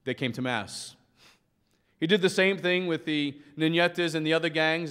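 ordinary talk

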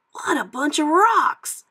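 high, loud puppy amazement; excited exclamation. A narrator's reading. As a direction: exclaim like an awestruck young pup, stretched out. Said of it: "exclaimed Scamp".

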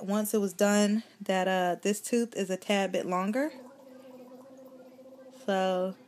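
lip pulled down by finger; talking while showing teeth; finger in mouth. Real, not acted. Seen 0: finger pulls lower lip aside to show tooth while talking.

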